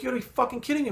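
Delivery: pitched well up in outrage; can't believe what he just heard; stunned disbelief. Retold or imitated. Reacting to the pigs' slaughter.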